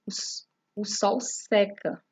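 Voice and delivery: seca said slowly with an open e. Shown as a demonstration. (seca com é aberto, devagar)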